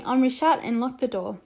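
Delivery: ordinary delivery, nothing marked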